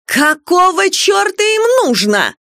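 high, drawn-out yell; startled shriek. A narrator's reading. Startled client clutching the salon owner's smock, shrieking. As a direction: shriek it, stretching the words. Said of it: "завопила".

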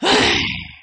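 high sighing exhale; long sigh. Acted. Prisoner sighs over the cowardly barber, weary sigh. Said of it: "Tư Mã Khố thở dài".

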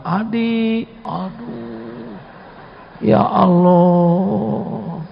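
high, stretched-out anguished wail; heartbroken cry. Acted. Boy left behind by the bus cries out to God, anguished. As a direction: let the Ya Allah ache, very slowly.

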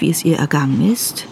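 usual speaking voice

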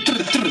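quick rattling "trr, trr" of an air wrench; imitated wrench noise. Worker mimes the automatic air wrench at work, imitated whirr. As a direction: mimic the pneumatic wrench in two short bursts.

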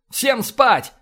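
loud, high bark in a borrowed voice; barked order. A narrator's reading. Linda mimicking Essex at fleeing astronauts, barked order. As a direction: bellow after the fleeing crew. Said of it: "рявкнула она вслед".

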